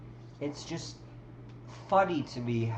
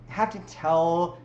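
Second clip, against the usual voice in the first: normal talking voice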